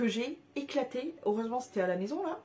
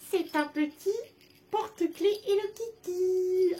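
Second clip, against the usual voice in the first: high, slow cooing presentation; cute cooing; 9 semitones higher, 3 dB louder, slower than usual. Real, not acted. Presenting Hello Kitty keychain.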